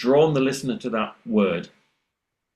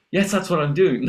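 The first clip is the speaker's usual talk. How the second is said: insistent student; 8 semitones higher than usual. Retold or imitated. Voicing a stubborn student.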